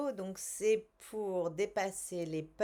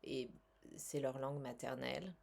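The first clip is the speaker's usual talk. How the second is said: calm, measured guided-relaxation voice; soothing self-help guide voice; guided tapping session. Acted. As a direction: lead them gently through the tapping.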